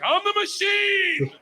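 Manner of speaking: very high, drawn-out yell; triumphant bellow. Retold or imitated. Young student telling a Russian gangster who he is, triumphant bellow. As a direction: belt it out like a battle cry, stretching the last word. Repeats his botched line to gangster.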